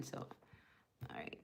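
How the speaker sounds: breathy aside, barely audible; whisper. Real, not acted. Whispering to herself while screenshotting a recipe.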